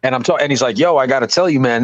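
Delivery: normal talking voice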